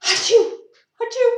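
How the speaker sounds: high, loud pretend sneezes; mock sneeze. Reader acting out the bee sneezing, high mock sneeze. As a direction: fake two quick high sneezes. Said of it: "And then the bee sneezes".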